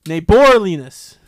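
insisting on the word; loud slow insistence. Shown as a demonstration. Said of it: "stressing the wrong syllable".

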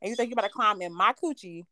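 rapid-fire mock rant; quick imitated scolding of boyfriend; fast retold scolding. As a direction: rattle it off fast.

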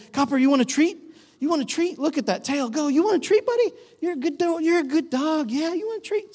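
high, sing-song praise for a dog; happy dog-talk voice. Shown as a demonstration. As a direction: coo at the dog like it's the best boy. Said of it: "he responds to our tone".

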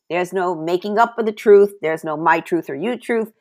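ordinary delivery

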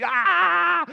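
long, high, loud mock scream; hammed-up "aah" of frustration; exasperated yell. Dad with no way to settle it, exasperated yell.

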